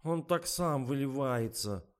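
sulky drawn-out whine; pouting excuse. A narrator's reading. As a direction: make the excuse through a pout. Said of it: "оттопырила нижнюю губу Катя".

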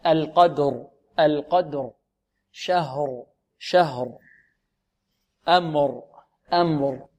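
slow "القدر", "شهر", "أمر", each r clearly sounded; voiced final r. Shown as a demonstration. Teacher corrects students word by word, voiced r. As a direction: give the last r of "شهر" full voice, pitch falling. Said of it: "فلا بد من إعطائها جهرها".

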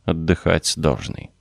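usual speaking voice

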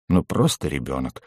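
low, soft dry aside; amused grunt. A narrator's reading. Bas chuckling about the goblin to a companion, low and dry. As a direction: remark it low, half to yourself. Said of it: "хмыкнул Бас".

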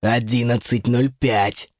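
low, slow demon voice; lightly voiced gloating demon, deep and measured; triumphant demon. A narrator's reading. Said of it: "В голосе демона она расслышала торжество".

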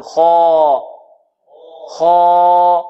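خا held long with a dark, heavy alif. Shown as a demonstration. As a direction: stretch خا full and rounded. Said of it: "هكذا نقول". (خا بألف مفخمة ممدودة)

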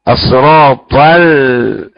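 "الصراط ال" with emphatic ta and a thin l, drawn out; heavy ta, light lam. Shown as a demonstration. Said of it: "الصراط الطاء مفخمة". ("الصراط ال" بطاء مفخمة ولام مرققة)

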